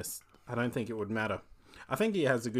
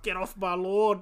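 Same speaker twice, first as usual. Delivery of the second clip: aussie tradesman; 7 semitones higher, 3 dB louder, slower than usual. Retold or imitated. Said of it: "he comes out and, like".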